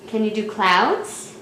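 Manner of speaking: ordinary talk, nothing marked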